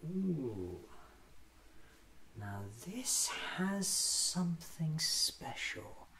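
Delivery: ordinary talk, nothing marked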